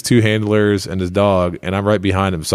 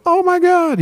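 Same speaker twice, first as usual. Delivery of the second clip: mock fan squeal; slower than usual. Fans geeking out at celebrity.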